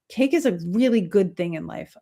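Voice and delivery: normal talking voice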